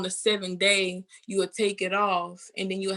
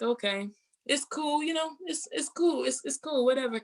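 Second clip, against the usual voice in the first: mock it's cool; 6 semitones higher than usual. Retelling her hurt, shrugging reply.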